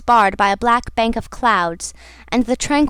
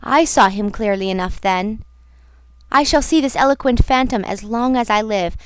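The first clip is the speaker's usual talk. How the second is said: ordinary delivery, nothing marked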